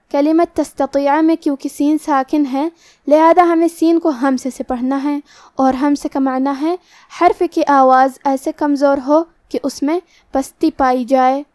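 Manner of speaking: ordinary delivery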